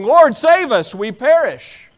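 high, loud desperate plea; frantic cry for help. Acted. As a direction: cry out as if the ship is sinking. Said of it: "But they bust in".

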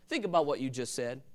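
ordinary delivery

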